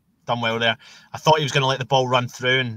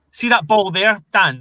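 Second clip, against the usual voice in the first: excited; 8 semitones higher, 7 dB louder than usual. Real, not acted. Pointing out a pass to friend.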